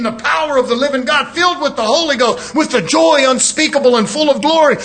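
ordinary talk

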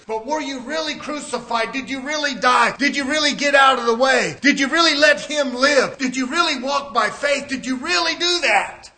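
loud, driving challenge to the congregation; preaching at full force. Real, not acted. Preacher challenging believers on whether they really died, full-force preaching. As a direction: fire each question out forcefully.

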